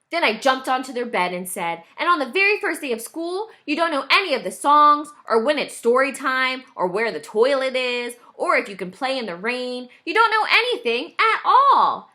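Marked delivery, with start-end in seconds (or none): 2.0-12.1 put-on high, loud little kid pleading his case; a boy bouncing on the bed making his point; insistent child. A narrator's reading.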